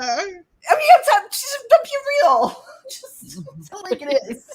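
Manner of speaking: high voice cracking with laughter; laughing hard. Real, not acted. Friends joking about her body, a laughing squeal.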